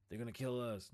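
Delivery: normal talking voice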